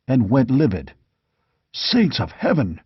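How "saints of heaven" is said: a startled, breathless exclamation; gasped in shock. A narrator's reading. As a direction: catch your breath on it, stunned. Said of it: "he gasped".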